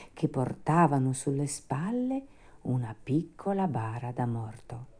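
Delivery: ordinary delivery, nothing marked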